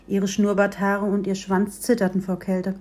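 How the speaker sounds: plain reading voice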